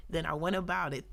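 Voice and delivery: usual speaking voice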